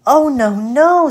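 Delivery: high, loud protest as the fly; little fly crying out a refusal; little fly refusing. Acted.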